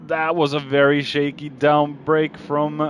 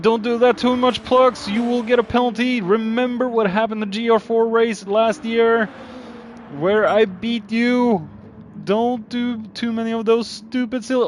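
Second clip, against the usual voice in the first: higher teasing call to the driver; mocking warning; 8 semitones higher than usual. Real, not acted. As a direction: needle him about last year's race. Teasing driver mid-race, higher pitch.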